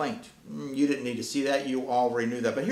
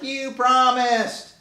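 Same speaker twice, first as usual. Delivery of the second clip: high, louder, slow drawn-out whine; whiny cat kid; 9 semitones higher, 5 dB louder, much slower than usual. Acted. Cat kid denied pancakes, slow high whining complaint. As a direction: drag it out like a let-down child. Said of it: "When cats and dogs don't get pancakes".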